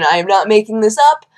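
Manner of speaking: normal talking voice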